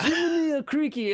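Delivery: put-on very high, loud samurai accent; exaggerated mock samurai-style Japanese accent. Traveler demonstrating what would be understood, samurai accent. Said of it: "like a samurai accent".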